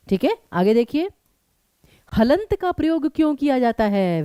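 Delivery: normal talking voice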